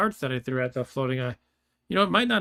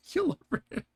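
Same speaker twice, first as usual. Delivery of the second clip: much higher, laughing disbelief; amused disbelief; 12 semitones higher than usual. Real, not acted. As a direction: repeat it stunned and giggling. Disbelief at killing Lord British.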